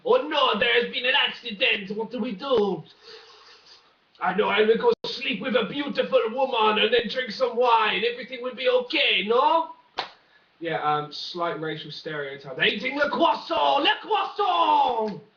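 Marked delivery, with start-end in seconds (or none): 0.1-9.7 high, loud hammed-up French accent; mock French accent. Comic riffing on the French train derailment, loud mock French accent. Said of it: "my French accent probably needs a lot of work". 12.6-15.2 put-on loud, high French accent, le croissant stretched slow; mock French accent. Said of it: "my French accent probably needs a lot of work".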